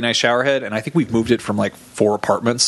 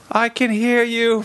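high, slow, spooky voice; drawn-out ghostly answer from downstairs; ghostly reply; 10 semitones higher, slower than usual. Retold or imitated. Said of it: "she was like".

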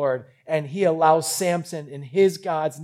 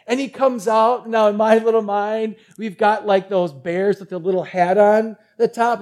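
ordinary talk

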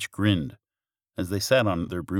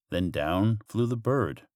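plain reading voice

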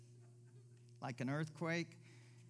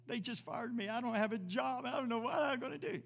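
mock panic; 8 semitones higher than usual. Voicing a panicked fired worker.

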